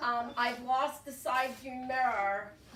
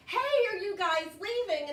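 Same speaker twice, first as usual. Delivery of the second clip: higher-pitched call to strangers; 7 semitones higher, 4 dB louder than usual. Retold or imitated. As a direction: call across to someone in a car. Calling to men in a van.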